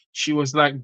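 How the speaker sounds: normal talking voice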